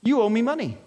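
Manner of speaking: a creditor shaking down a debtor; mock demanding servant. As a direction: lean on him like a creditor. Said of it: "and he goes".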